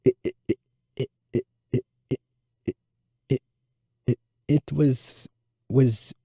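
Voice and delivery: 1 stutter. A narrator's reading.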